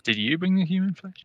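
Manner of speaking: a ghoulish sing-song demand; creepy put-on voice. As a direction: echo it like a creepy villain. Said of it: "repeats the line in a put-on voice".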